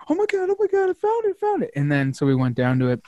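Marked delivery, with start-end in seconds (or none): 0.1-1.7 very high mock squeal; imitating excited wife. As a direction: squeal it like her, jumping for joy.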